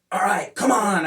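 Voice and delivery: imitating his own mad "come on"; mock annoyed. Telling his student to sit and learn, annoyed. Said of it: "I would be mad".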